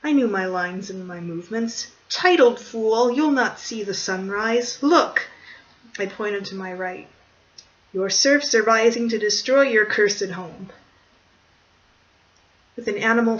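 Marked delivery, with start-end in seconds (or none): 2.1-5.3 slow, loud, higher defiant challenge; heroic challenge. Acted. Hero defies Count. 7.9-10.7 loud heroic taunt. Acted. Hero taunts.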